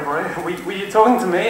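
usual speaking voice

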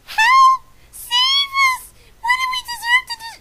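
squealing comic panic, the last line rushed; panicked cry for help. Acted. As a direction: scream for rescue like a cartoon victim. Characters cry for rescue.

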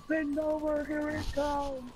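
high, drawn-out sing-song callout; imitated officer. Retelling to friends what the officer said, imitated high callout. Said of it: "all I hear is".

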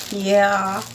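usual speaking voice

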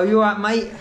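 Essex "You all right, mate?" in a laughing cockney voice; Essex accent. Shown as a demonstration. Vlogger demoing Essex slang for the shop auntie, in a light Essex accent. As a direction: throw it out like a cheerful Essex lad. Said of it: "to learn Essex accent".

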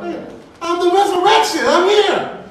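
very high, loud protest; exasperated outcry. Acted. Answering mourners who doubt who he is, exasperated outcry. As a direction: cry it out in acted disbelief. Voices Jesus exasperated at disbelief.

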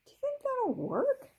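put-on high, uncertain girl voice; unsure if it'll work; high questioning child voice; doubtful girl. A narrator's reading. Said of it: "Rachel said to Kirsty".

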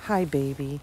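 ordinary talk, nothing marked

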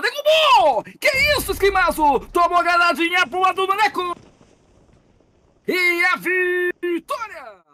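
very high, loud victory yell; ecstatic shout. Real, not acted. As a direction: scream the winning call. Match-winning knockout call.

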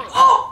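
loud, high yelp; startled cry. Real, not acted. Player losing ground in the game, loud high cry. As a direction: yelp out in sudden alarm.

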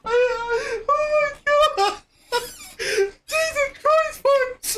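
high squealing words through tears of laughter; barely able to speak for laughing; crying with laughter. Real, not acted. Helpless laughter at the clip.

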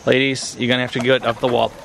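usual speaking voice

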